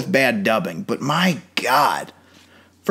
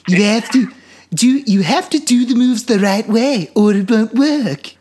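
high, loud cartoon baby voice slurring; drunk Stewie; 9 semitones higher, 4 dB louder than usual. Mocking the master's later dub as drunk Stewie, higher still. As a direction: slur it like a tipsy cartoon baby. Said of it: "drunk Stewie".

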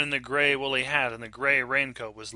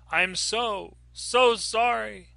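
higher, drawn-out call after her; 10 semitones higher, 3 dB louder, slower than usual. A narrator's reading. Lily calling out to the vanishing woman, pleading. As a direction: call it after someone disappearing. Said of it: "Lily called out".

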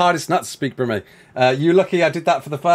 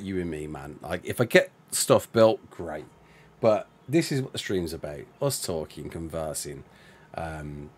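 much softer, lower, trailing; hushed sincere aside; 9 dB quieter than usual. Real, not acted. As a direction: talk quietly and sincerely to chat. Voice drops very low and soft.

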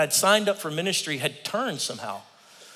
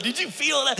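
much higher, thrilled question; asking his wife, thrilled; excited whisper-aside; 11 semitones higher than usual. Retold or imitated. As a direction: lean over, thrilled.

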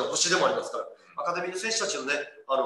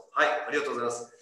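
normal talking voice

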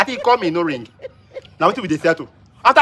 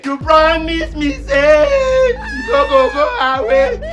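slow, drawn-out crying complaint; hammed-up lovelorn wail, words stretched out; heartbroken wailing; 2 semitones higher, much slower than usual. Trumpeter finding his girlfriend at the party, wailing heartbreak.

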